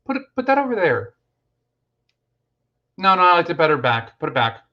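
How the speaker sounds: quick, mock directions from a lounge chair; imagined bossy wife. Streamer imagining a viewer bossing her husband, playfully mock-bossy. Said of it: "a video of you just going".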